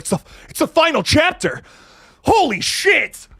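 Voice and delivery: excited fan at the movie announcement; hyped moviegoer. Retold or imitated. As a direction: play a fan losing it over the final chapter. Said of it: "I got really excited".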